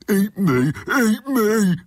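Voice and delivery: high loud bellowing cow. A narrator's reading. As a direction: bellow at her.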